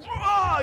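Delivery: loud high mock scream; imitated shriek of fright; startled scream. Teenagers caught misbehaving flip out, startled scream. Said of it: "And they would just".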